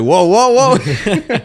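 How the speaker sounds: high, loud startled cries; startled whoa. Retold or imitated. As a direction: yelp it like a shocked bystander. Man in the video reacting.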